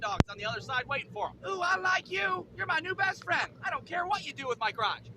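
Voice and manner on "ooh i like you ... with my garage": delighted dog. Acted. Eager dog greeting the vet, high-pitched delight. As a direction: gush like an over-friendly dog. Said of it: "He practically tap dances his ass back there".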